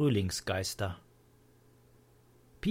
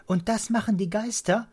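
put-on high, loud child's question; childlike, higher-pitched wondering; curious child; 8 semitones higher, 6 dB louder than usual. A narrator's reading. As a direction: ask in eager disbelief.

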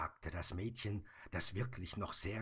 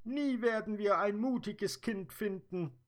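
high, louder fairy voice; sad fairy; 14 semitones higher, 7 dB louder than usual. Acted. Dream fairy explaining to the Sandman, high and louder. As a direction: speak as the tearful dream fairy.

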